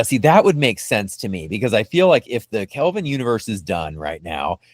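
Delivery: ordinary delivery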